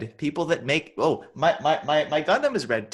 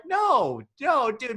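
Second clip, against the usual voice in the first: high-pitched incredulous objection; mock protest; 9 semitones higher, much slower than usual. Friend acting out a comic-book argument to dodge feelings, mock protest. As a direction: argue a trivial point in a high voice. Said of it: "And I'm like".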